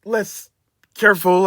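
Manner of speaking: high, nervous stammering; flustered apology. Acted. Richard nervously apologizes to the angry workman. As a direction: stumble over the apology.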